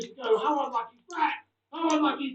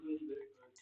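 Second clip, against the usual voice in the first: quieter, lower repeat of "Thank you, the Hoop Man"; soft repeat; 6 semitones lower, 11 dB quieter, faster than usual. Real, not acted. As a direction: murmur the thanks again.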